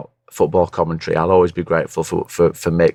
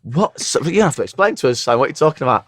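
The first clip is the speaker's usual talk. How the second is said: baffled; 7 semitones higher than usual. Retold or imitated. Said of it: "And I was like, going".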